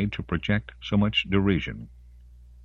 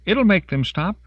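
high, loud urging; urgent plea; 5 dB louder than usual. A narrator's reading. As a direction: urge them desperately to act. Denise pleading during the fight.

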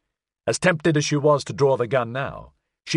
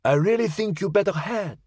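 put-on high old lady, gently urging; high-pitched, insistent elderly neighbour; elderly French woman; 8 semitones higher than usual. A narrator's reading. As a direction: press the point in a high Frenchwoman's voice. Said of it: "she said".

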